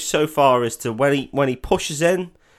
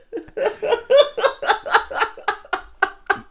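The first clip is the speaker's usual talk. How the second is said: long, high-pitched laugh; helpless laughter; 3 dB louder than usual. Real, not acted. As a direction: burst out laughing and keep going. Helpless at co-host's crude remark.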